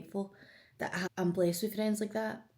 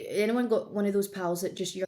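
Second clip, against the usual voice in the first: ordinary talk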